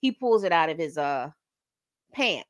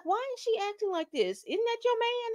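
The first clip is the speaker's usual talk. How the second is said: much higher, pointed questioning voice; suspicious gossip; 13 semitones higher, 5 dB quieter than usual. Retold or imitated. Rehab Bae questioning Will about Lisa, high nosy voice.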